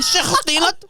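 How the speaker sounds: put-on "Sick as steam, lad" squeezed high, in a broken Kurdish-English accent; imitating Kurdish kid. Spelling out the joke to friends, Kurdish accent.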